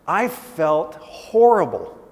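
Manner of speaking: slow and loud on "horrible"; drawn-out, weighty admission of regret; heavy emphasis. Retold or imitated. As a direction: stretch out "felt horrible" with force. Father recounts his regret.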